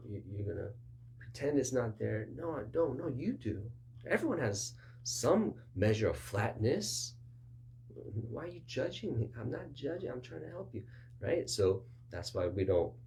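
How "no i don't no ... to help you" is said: two sides of a mild quarrel; mock argument. Defensive person arguing with a helper, mildly. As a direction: bicker back and forth, light. Acted quarrel about flatness.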